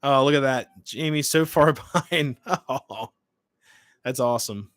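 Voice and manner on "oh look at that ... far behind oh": ordinary talk, nothing marked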